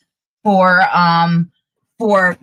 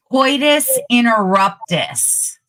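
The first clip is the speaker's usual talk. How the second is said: slow, higher, deliberate unveiling; drawn-out announcement; 4 semitones higher, slower than usual. Real, not acted. Revealing the correct medical term to the co-host, drawn-out announcement. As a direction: stretch out "coitus interruptus" like a big reveal.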